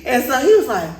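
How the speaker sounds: ordinary talk, nothing marked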